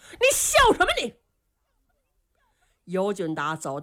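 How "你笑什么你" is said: high, fast angry demand; furious. Acted. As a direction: snap it out in a rage. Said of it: "程咬金气坏了". (气急败坏地质问)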